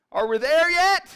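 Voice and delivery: put-on high, loud child's whine; child nagging on a long drive; whiny kid. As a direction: pester dad from the back seat. Kids in the back seat.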